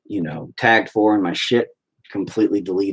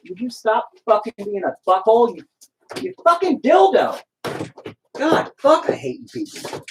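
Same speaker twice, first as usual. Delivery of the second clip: frustrated outburst; 12 semitones higher than usual. Real, not acted. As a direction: snap at the uncooperative paint. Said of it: "I still am getting angry".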